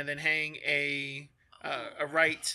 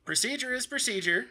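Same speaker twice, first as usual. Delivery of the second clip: raised, higher-pitched rule-bound voice; officious guard; 6 semitones higher than usual. Acted. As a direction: lecture them on the rules in a high voice. Game master voices the guard.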